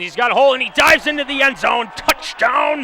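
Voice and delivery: climbing excited shout; touchdown call. Real, not acted. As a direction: yell it as he scores.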